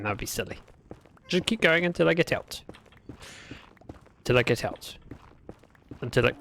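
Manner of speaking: ordinary talk, nothing marked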